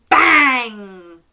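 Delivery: explosive, falling door slam; big, punchy storytime sound effect; loud bang. Shown as a demonstration. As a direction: shout it like a slamming door. Models slamming noise for children.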